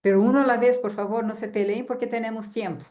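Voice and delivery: ordinary talk, nothing marked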